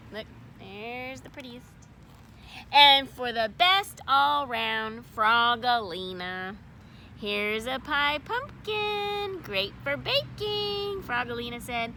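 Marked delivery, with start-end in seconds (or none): 2.7-11.0 announcing. Acted. Teacher announcing winners.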